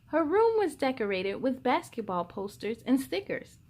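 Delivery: usual speaking voice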